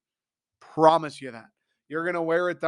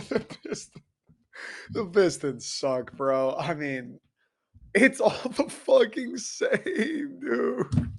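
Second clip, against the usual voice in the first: slow, giggling, last words squeaked up high; fighting off laughter and losing; laughing; 6 semitones higher, slower than usual. Real, not acted. Host cracking up that every Detroit team is bad, laughing. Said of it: "I can't stop laughing".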